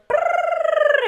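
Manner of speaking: high rolled brrr; vocal trill imitation. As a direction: trill your lips like the singer. Imitating the singer's run.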